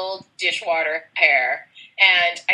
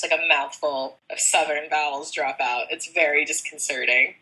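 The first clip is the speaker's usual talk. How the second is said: ordinary delivery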